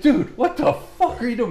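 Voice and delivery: high, loud retold outrage; angry outburst. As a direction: act out an irate dad confronting the doctor. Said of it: "He was so pissed at Doc".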